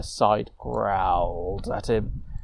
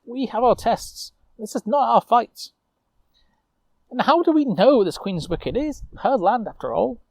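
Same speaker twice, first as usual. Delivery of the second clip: earnest arguing knight; 9 semitones higher, 3 dB louder than usual. Acted. Osmond argues with Lucille.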